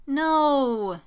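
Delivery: high, loud, drawn-out whine; whining. A narrator's reading. Jess refuses to talk to Rey, whining. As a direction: stretch the word into a whine. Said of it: "she whines".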